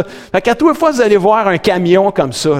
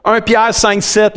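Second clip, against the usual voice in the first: ordinary talk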